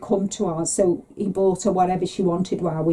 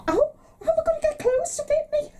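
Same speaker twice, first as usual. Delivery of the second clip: much higher, crying mock wail. Mum wails about clothes not fitting, hammed-up crying.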